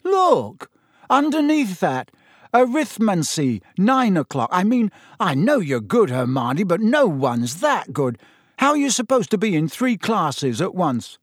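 high, loud incredulous exclaim; nobody could manage this, say it amazed; a boy incredulous at his friend's timetable; disbelieving. A narrator's reading.

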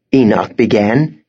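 plain reading voice

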